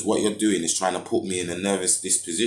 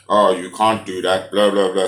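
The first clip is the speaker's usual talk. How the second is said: mocking the officers; 8 dB louder than usual. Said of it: "they just try to enforce".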